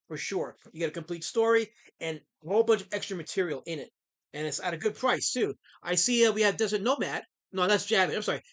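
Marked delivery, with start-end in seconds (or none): none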